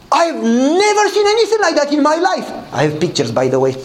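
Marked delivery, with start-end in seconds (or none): 0.1-2.5 amazed. Retold or imitated. Neighbor seeing the garden for the first time, amazed. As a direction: marvel at it, voice pitched way up.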